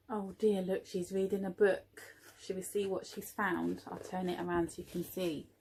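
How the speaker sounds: soft, confiding, turning the book round; a gentle hushed aside; quiet aside. Real, not acted. Reader's quiet aside to child.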